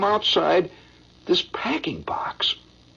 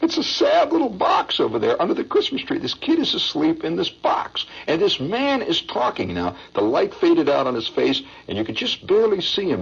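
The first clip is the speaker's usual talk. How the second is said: usual speaking voice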